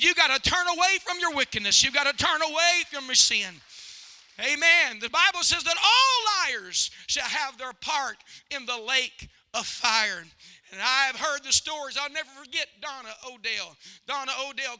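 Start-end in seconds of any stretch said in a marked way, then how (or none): 0.0-10.2 loud, emphatic pulpit call to turn; full-force preaching. Real, not acted. Preacher warns the congregation about sin, full-force preaching. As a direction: preach it loud, stretching the key words.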